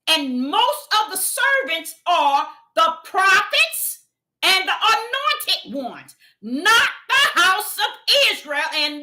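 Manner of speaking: fervent pulpit preaching, slowed down. Acted. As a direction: drive it home to the congregation. Preaching at full force.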